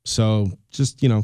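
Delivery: normal talking voice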